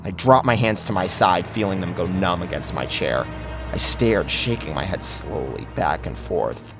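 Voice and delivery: ordinary delivery, nothing marked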